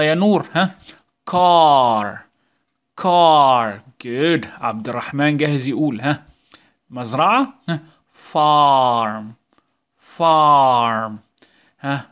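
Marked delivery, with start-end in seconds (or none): 1.3-3.8 star said loud and slow, ar stretched; slow loud model reading; drawn-out teaching read. Shown as a demonstration. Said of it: "يلا مع بعض". 8.3-11.1 star said loud and slow, ar stretched; slow loud model reading; drawn-out teaching read. Shown as a demonstration. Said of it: "يلا مع بعض".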